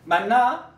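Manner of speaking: 만나? with a rising Gyeongsang pitch; Gyeongsang-style rising 만나?. Shown as a demonstration. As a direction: lift the end of 만나? upward. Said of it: "이렇게 올라가는 게 있었고".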